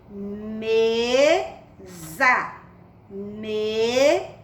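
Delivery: "mesa" como "meee... za"; leitura silabada. Shown as a demonstration. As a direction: leia "mesa" separando e alongando as sílabas. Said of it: "com som de". ("mesa" silabado, com som de Z)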